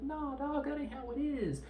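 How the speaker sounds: very high-pitched hammed-up objection; mock protest. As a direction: step in with a comic high voice. Acting out Bond stepping in.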